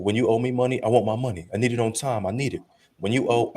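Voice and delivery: normal talking voice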